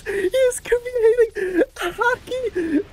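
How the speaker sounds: high, slow, tearful amazement; barely holding it together with joy; real choked-up excitement; emotional awe. Real, not acted. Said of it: "I'm actually getting emotional".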